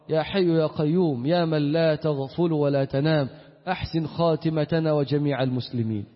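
ordinary delivery

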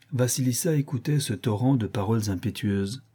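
ordinary delivery, nothing marked

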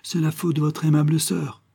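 lightly gallant, a smile in the voice. Acted. Parisian guest excusing himself, smiling gallantly toward Thérèse. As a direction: charm her with a courtly smile. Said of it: "avec un sourire galant".

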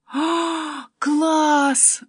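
high, drawn-out gasp of delight; thrilled exclamation. A narrator's reading. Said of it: "воскликнула Полька". (восторженное восклицание)

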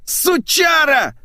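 drawn-out, very high scream; yelling. Acted. Boss interrupting employee's request, yelling. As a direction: scream the insult in fury. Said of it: "заорал Сергей".

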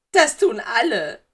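loud, slow, high-pitched complaint; weinerliches Geistermädchen. A narrator's reading. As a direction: drag out the ghost girl's grievance loudly. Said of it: "Sie bekam Schluck auf". (laut und langgezogen klagend)

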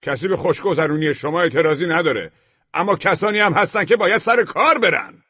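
very high-pitched, loud rant; heated quarrel at full pitch; angry shouting. A narrator's reading. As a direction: yell your outrage at the top of your range. Neighbour rants in escalating quarrel.

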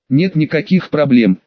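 ordinary delivery, nothing marked

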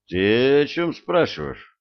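lost the thread completely; confused. A narrator's reading. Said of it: "совсем запутался второй".

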